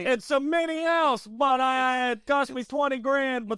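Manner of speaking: hammed-up high Italian-American mobster; Italian mafia. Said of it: "we instantly slip into Italian mafia people".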